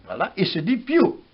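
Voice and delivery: ordinary talk, nothing marked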